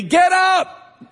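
high, loud shout of an angel; angel screaming. Retold or imitated. Preacher playing the angel shouting at sleeping Joseph, loud high yell. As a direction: yell it like an angel jolting you awake. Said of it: "an angel who screams at me".

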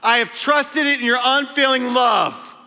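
high, loud declaration; a sudden shouted turn in the psalm; shouted scripture. Retold or imitated. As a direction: proclaim it full force. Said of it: "So I'm screaming that".

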